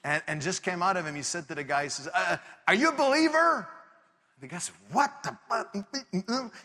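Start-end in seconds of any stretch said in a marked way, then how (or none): 2.1-3.7 high-pitched, impulsive blurt; blurted question. Retold or imitated. Startled man in the car to the guy yelling at him, high blurted question. 4.9-6.5 high, drawn-out mock cursing; imitated cursing. Furious guy answering the stranger's question, imitated cursing. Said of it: "Cursing in French".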